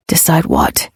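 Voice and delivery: low male character voice. A narrator's reading. Ledger answers her, male voice.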